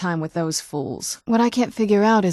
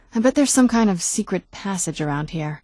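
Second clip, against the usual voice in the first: ordinary delivery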